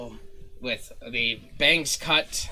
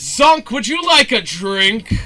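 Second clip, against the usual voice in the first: loud, higher, drawn-out theatrical offer; effusive host; 9 semitones higher, 12 dB louder than usual. Acted. Showman offering Zonk a drink, effusive. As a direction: play the grand host, slowly and loudly.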